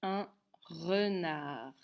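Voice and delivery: very slow, drawn-out dictation; dictation pace. Shown as a demonstration. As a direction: stretch it out for writing. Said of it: "comment on écrit un renard". (dictée très lente, bien étirée)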